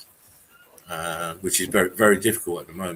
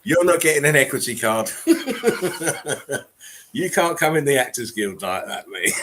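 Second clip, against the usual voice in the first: laughing; 7 semitones higher, 4 dB louder than usual. Real, not acted. Teasing co-host while laughing.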